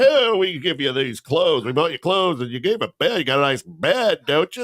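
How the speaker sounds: hammed-up preachy parent; mocking parent. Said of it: "Don't fucking give me that".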